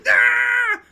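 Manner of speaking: sudden high piercing shout; a full-force martial arts yell; battle cry. Acted. Goblin yells as he launches his axe kick.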